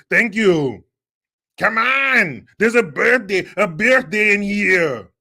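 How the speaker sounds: loud, high, slow Austrian strongman shouting; hammed-up Austrian action-hero bellow, half-sung birthday song; Schwarzenegger. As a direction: belt it out in a mock Austrian accent. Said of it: "with a Schwarzenegger birthday song".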